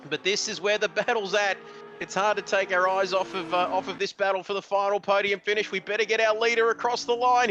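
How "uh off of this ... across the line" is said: ordinary talk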